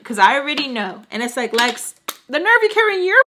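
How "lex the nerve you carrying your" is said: high, loud sassy scolding voice; sassy inner voice. Retold or imitated. Her inner voice sassing her, high and loud. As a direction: sass yourself in a high put-on voice.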